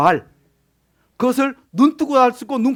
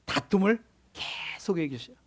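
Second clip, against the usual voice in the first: whisper; 9 dB quieter than usual. Real, not acted. Sermon aside on jealousy.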